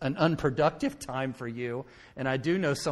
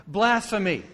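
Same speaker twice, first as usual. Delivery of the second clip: higher, louder, falling Blasphemy; firm verdict; 7 semitones higher, 6 dB louder than usual. Real, not acted. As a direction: land Blasphemy as a final word. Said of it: "let me give you a word for it".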